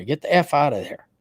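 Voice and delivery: usual speaking voice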